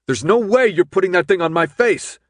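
high, loud panicked shout; hysterical shout. Acted. Thomas shouts at the guards, hysterical.

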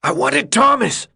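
high, loud furious yell; a sick boy suddenly yelling. A narrator's reading. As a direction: burst out yelling. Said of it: "Albie yelled".

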